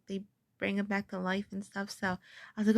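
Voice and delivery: usual speaking voice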